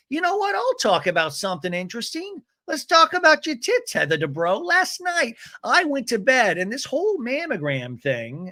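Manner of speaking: high, emphatic imitation; mimicked confrontation. Voices Gina launching into confrontation.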